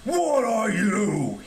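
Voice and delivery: strained, roaring giant voice; giant's growl. A narrator's reading. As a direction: growl it slowly, full of contempt. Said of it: "he growled".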